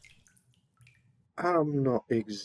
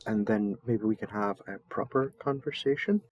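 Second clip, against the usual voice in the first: ordinary delivery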